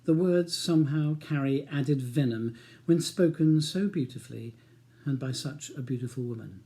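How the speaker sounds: ordinary delivery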